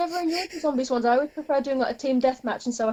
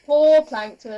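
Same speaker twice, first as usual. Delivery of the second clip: poor Plankton said louder, hamming up the pity; louder mock pity; 8 dB louder, much slower than usual. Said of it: "Plankton is not poor".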